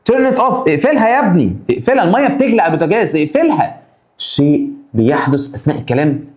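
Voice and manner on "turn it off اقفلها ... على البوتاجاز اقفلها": higher-pitched mock mother calling her son; urgent parent. Mother calling son about boiling water, mock urgent. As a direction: hurry the boy to shut off the boiling water.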